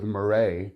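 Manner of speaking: Murray with the stress thrown onto a drawn-out second syllable, "mur-RAY"; imitated Murray pronunciation. Film reviewer mimicking Joker on the talk show, mock-fancy Murray pronunciation. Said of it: "I had to say it like that".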